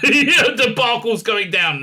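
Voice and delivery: amused, high burst of laughter into talk; laughing through words. Real, not acted. Laughs at next possible scandal.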